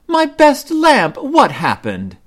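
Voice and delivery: high, loud and slow, aghast; upset dismay. A narrator's reading. Mama asking her cubs about the broken lamp, dismayed. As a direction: wail it out over the lamp.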